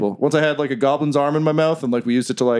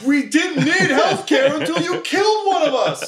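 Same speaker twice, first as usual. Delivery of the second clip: much higher, slow furious dwarf yell; angry shout; 13 semitones higher than usual. Acted. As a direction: hurl the blame at them, shouting. Dwarf yells at the party.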